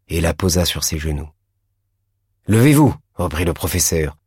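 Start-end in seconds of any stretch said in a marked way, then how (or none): 2.5-3.0 louder, firmer order to stand up; the teacher repeating his order, stern; stern command. A narrator's reading. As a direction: snap it at the pupil.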